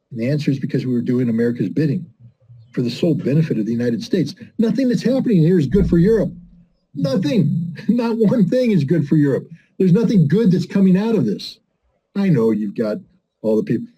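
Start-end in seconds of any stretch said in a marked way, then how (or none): 4.6-11.6 high-pitched, vehement denial; emphatic insistence. Real, not acted. Speaker warns Europeans nothing good comes of this, high emphatic insistence. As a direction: drive each negation home at a raised pitch.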